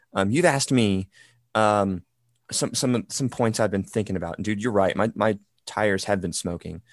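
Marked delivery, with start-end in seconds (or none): none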